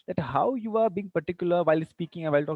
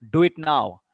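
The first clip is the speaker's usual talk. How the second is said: louder, firm order; loud command; 7 dB louder than usual. Shown as a demonstration. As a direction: give it as a command. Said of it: "that can be a command".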